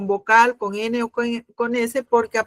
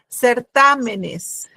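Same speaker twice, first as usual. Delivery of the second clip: Certámenes drawn out slowly with TA stressed; slow syllable stress; much slower than usual. Shown as a demonstration. Teacher dictates Certámenes slowly, stressing TA. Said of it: "Seguimos con las esdrújulas".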